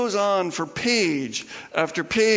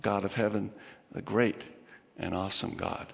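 softer, lower and hushed; reverent hush; 9 semitones lower, 7 dB quieter than usual. Shown as a demonstration. Preacher hushing before the great and awesome God. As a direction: let the words sink to a hush. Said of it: "reminded that He is the Lord".

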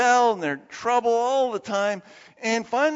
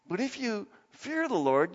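ordinary delivery

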